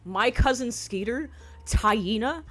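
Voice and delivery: ordinary talk, nothing marked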